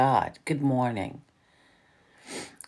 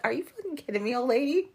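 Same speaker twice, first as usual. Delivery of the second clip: incredulous retort; 11 semitones higher, faster than usual. Retold or imitated. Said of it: "I was like".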